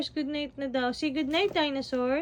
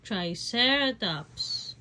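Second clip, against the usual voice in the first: Triceratops sounded out slowly syllable by syllable; slow teaching word; 2 semitones lower, 4 dB louder, slower than usual. Shown as a demonstration. Mother teaching her toddler the dinosaur name, slow and clear. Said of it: "What dinosaur is this?".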